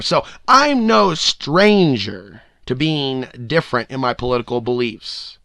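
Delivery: usual speaking voice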